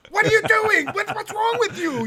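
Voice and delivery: high, loud mock scolding; yelling. Dad yelling at his hurt kids, high-pitched yell. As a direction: reenact yelling at your hurt kid. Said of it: "and I yell at them".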